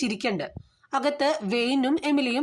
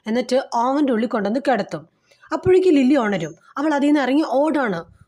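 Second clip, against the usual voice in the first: louder, gripping storyteller building to the climax; tense climax narration; 5 dB louder than usual. Acted. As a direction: push the narration up as the horror peaks. Climax of the film recap. (ആവേശത്തോടെ ഉച്ചത്തിലുള്ള climax വിവരണം)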